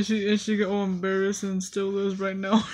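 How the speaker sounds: ordinary talk, nothing marked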